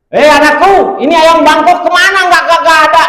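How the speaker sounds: high, loud, excited voices; excited family exchange. Acted. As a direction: play the alarmed father and his son. Kiai and son quarrel. (ayah kaget bertanya pada anaknya dengan suara tinggi)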